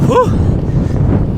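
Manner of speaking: a long unvoiced exhale that lifts at the end; breathless whew. Real, not acted. Cyclist climbing a gradual hill, puffing out a rising whew. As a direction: puff it out, winded from the hill. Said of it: "you can probably tell I'm out of breath already".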